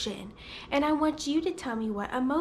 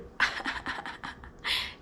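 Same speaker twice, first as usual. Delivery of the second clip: light, breathy run of ha-ha-ha; demonstrated happy laugh; 6 dB quieter than usual. Shown as a demonstration. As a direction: laugh on cue to show happiness. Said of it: "Some people laugh when they're happy".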